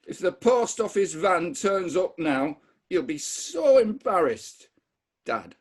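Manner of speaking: put-on scolding Dad in a Bradford accent. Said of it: "I'm gonna try my Bradford accent".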